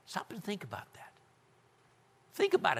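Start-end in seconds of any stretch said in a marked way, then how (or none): 0.1-1.1 very soft, hushed invitation to ponder; reflective whisper. Acted. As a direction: drop to a whisper for the pause. Repeats Selah gloss in hush.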